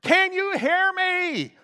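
retelling a desperate prayer, yelling "God!". Retold or imitated. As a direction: shout up to a silent heaven. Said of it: "And I'm yelling".

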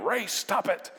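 playful scolding, pitched high; mock scolding yell. Wife and daughter scolding Ray's teasing, high mock yell. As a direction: tell him off half-laughing. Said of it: "yelling at him".

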